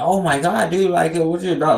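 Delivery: high, loud mock excitement of a passerby; mimicked gushing strangers. Strangers fussing over the host's dog, mockingly high gushing voice. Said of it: "and they be like".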